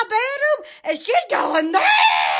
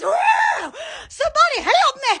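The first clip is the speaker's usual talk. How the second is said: high hammed-up call for help; mock cry for help; 9 semitones higher than usual. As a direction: yelp it out like a play distress.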